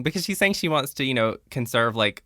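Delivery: ordinary talk, nothing marked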